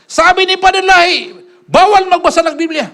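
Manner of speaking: high, slow, sing-song mockery of "Padre Lai"; sneering imitation of the rival; mocking quote. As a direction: stretch "Padre" up high to ridicule it.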